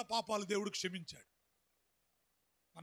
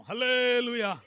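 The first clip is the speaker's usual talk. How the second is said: loud, drawn-out hallelujah; hallelujah shout; 9 dB louder, slower than usual. Real, not acted. Preacher leading the congregation in hallelujah, shouting. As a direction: shout it to lead the church.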